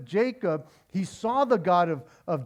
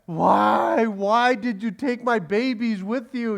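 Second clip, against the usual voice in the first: whiny, louder self-pitying Laban; playing the victim; 4 semitones higher, 5 dB louder, slower than usual. Laban whining to Jacob, playing the victim, loud and high. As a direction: whine it like the wronged party. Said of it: "playing the victim".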